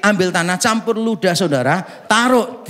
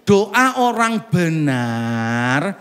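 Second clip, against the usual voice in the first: slow scripture quote; 3 semitones lower, much slower than usual. Shown as a demonstration. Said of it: "Ayatnya cuma berkata begini".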